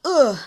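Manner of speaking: short, loud demonstrated "uh" grunt; punched-in-stomach grunt. Shown as a demonstration. Teacher likening a vowel to a stomach punch, grunted "uh". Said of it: "just got punched in the stomach".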